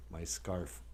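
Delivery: ordinary delivery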